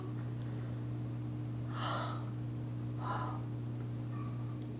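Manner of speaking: long, soft, airy sigh; heavy exhale of disbelief. Acted. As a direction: let out a quiet, drawn-out breath. Sighs over the Zoom funeral.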